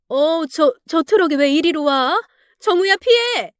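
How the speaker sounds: rising panic into a high yell; panicked warning. Acted. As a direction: scream the warning at the driver. Truck about to hit car.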